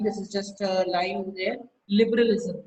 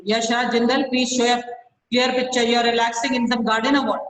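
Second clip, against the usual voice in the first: louder, fast, mocking reprimand; sarcastic scolding; 3 semitones higher, 5 dB louder, faster than usual. Real, not acted. Teacher scolding a student for a blurry video, loud sarcastic rebuke. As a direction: snap at her with sarcasm.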